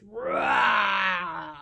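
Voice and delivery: long, loud playful roar; dragon roar. Pretend. Ending the dragon song for toddlers, big roar. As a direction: let out a big rumbling roar.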